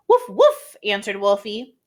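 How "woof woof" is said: very high, loud yapping woof woof; dog barks. Acted. Wolfie answers Miss W, high yapping bark. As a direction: bark eagerly like a small dog.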